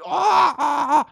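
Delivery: loud, high craving oh; excited moaning. Real, not acted. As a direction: moan with sudden sweet craving. Craving sour sweets. (aufgeregtes lautes Oh)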